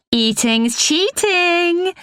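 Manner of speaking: put-on high, drawn-out non-Nottingham accent. A narrator's reading. Leah declares eating is cheating, in a high non-Nottingham accent. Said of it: "in her distinctly non-Nottingham accent".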